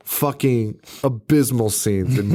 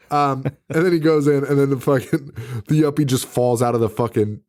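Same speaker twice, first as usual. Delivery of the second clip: ordinary delivery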